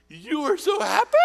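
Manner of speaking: put-on high, loud old-lady squeal; imitating the old woman. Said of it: "And she says".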